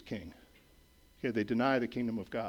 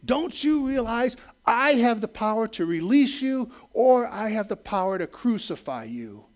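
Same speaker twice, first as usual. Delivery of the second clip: demanding Pilate; 8 semitones higher, 6 dB louder than usual. Retold or imitated. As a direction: lean in and threaten him with your power. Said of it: "Pilate demanded".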